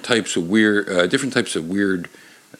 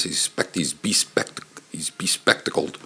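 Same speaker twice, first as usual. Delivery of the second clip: hushed word fumble; 5 semitones lower, 7 dB quieter than usual. Real, not acted. Stumbling over a word, hushed.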